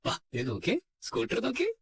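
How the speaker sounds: very high cooing at the end; singsong child-coaxing voice; luring baby talk. Acted. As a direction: tempt a toddler with a treat. Luring child to look at scooter.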